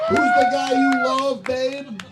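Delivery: comic high-pitched voice, loud; high mock voice. Comedian teasing his wife in a squeaky voice.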